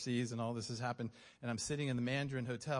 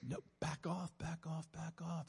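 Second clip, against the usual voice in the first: higher, urgent repeated warning; voice of the Lord; 4 semitones higher, slower than usual. Retold or imitated. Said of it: "the Lord's like".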